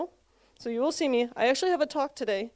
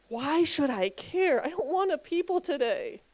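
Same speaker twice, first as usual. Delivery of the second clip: whiny audience voice; 4 semitones higher, 3 dB quieter, slower than usual. Retold or imitated. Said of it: "you're probably wondering".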